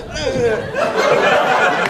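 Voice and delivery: loud high grunt of disgust; hammed-up angry ugh; mock angry grunt. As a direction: grunt it like a mad old man. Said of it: "He goes".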